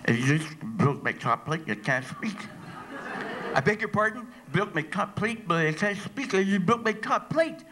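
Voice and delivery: mushy words through a toothless mouth; mumbling without dentures. Acted. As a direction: mumble as if your top plate just snapped. Speaker mumbles with broken dentures.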